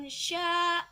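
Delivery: "sya" sustained for two beats; panjang dua ketukan. Shown as a demonstration. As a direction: hold the a of "sya" for two counts. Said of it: "Panjang ya, dua ketukan". ("sya" ditahan dua ketukan)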